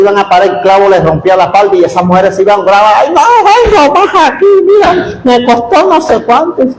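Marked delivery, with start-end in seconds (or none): 3.0-6.6 high, whiny complaining woman's voice; high-pitched mock lament; mocking upset churchwoman. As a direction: play the fussy lady whose dress got ruined. Angry churchwomen mocked.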